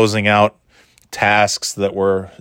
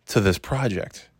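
ordinary delivery

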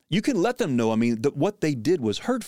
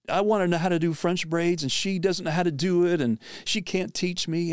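higher, lightly voiced upset teenage girl; upset daughter; 7 semitones higher than usual. Teen daughter complaining about her mother, higher and mildly whiny. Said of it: "mad with her mother".

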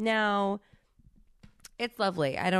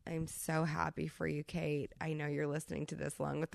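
tender; 2 semitones lower, 9 dB quieter than usual. Real, not acted. Tender congratulations to co-host.

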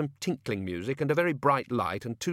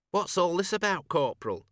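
higher-pitched questioning constable; puzzled constable; 7 semitones higher than usual. A narrator's reading. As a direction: ask the corporal in a raised pitch. Constable questions his corporal.

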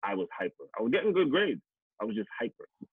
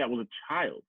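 ordinary talk, nothing marked

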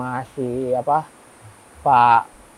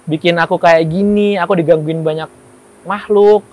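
louder, higher indignant telling-off; angry scolding; 3 semitones higher, 6 dB louder than usual. Retold or imitated. Scolding Dion after the haunted ritual, angry rant. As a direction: scold a friend who dragged you in.

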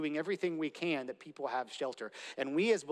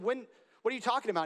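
surprised protest; 6 semitones higher, much faster than usual. Acted. Confused people questioning Jesus.

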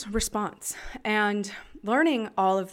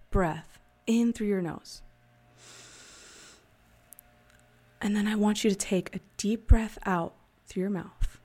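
guided breathing; 4 dB louder than usual. Acted. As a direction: talk them through each breath, unhurried. Leads a breathing exercise.

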